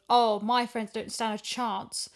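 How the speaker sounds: normal talking voice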